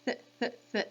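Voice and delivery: Th repeated softly with tongue behind the teeth; soft th sound drilled; th sound demo. Shown as a demonstration. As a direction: hiss out Th, th, th as a soft breathy th. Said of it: "your tongue is behind your teeth".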